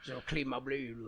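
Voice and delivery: soft low goofy murmur; lightly voiced dopey intruder; mock intruder. Joins the mock voice.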